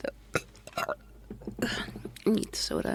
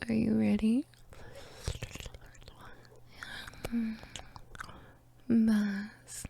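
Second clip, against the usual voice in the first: ASMR whisper; 6 dB quieter, slower than usual. Acted.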